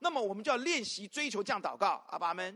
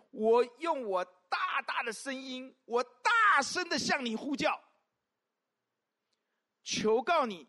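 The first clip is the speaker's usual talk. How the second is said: slow, loud and lifted up, calling out to God; fervent loud prayer, drawn out; loud crying out to God; 3 semitones higher, 6 dB louder, slower than usual. Shown as a demonstration. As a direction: cry out big and slow, as the original means. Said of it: "原文是大声地求你".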